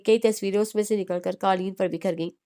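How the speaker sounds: usual speaking voice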